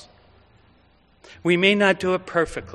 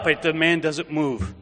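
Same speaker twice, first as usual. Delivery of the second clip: ordinary talk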